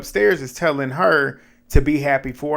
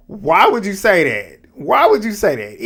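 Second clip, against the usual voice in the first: loud, higher, stunned repetition; shocked disbelief; 8 semitones higher, 7 dB louder than usual. Real, not acted. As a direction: demand it in disbelief. Shocked by insult.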